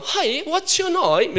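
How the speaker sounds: singsong mock British accent; British teacher. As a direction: put on a British teacher's greeting. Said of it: "그 영국인이에요".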